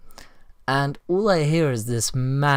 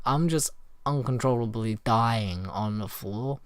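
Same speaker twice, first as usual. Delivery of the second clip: usual speaking voice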